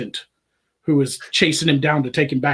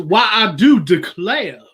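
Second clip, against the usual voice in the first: loud, slow hammed-up Southern drawl; Southern gentleman accent; 5 semitones higher, 6 dB louder, slower than usual. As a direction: stretch it out in a showy Savannah accent. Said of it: "a sweet accent".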